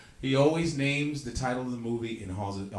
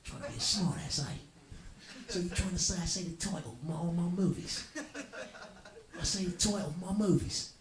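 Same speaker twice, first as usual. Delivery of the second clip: higher indignant mock voice; hammed-up prickly hero; offended movie star; 7 semitones higher than usual. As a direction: play the touchy star, pitched up. Voicing Jet Li scolding Statham.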